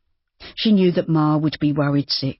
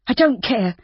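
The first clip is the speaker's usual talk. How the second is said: high, passionate outburst; cried passionately; 8 semitones higher than usual. A narrator's reading. Judy crying out to Rob, passionate and high. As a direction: fling it at him. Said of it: "she cried passionately".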